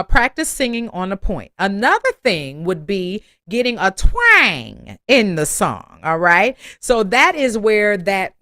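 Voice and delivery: usual speaking voice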